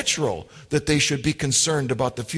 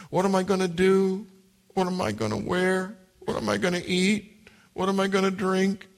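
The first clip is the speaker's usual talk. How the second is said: mock fretting worrier, voice pitched up; fretful worrier; 3 semitones higher, 3 dB quieter than usual. Preacher mocking an anxious believer's fretting. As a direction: whimper the worries like a fussing person.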